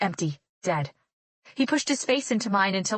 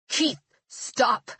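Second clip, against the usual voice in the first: croaked out; 4 semitones higher than usual. A narrator's reading. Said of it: "I croaked out".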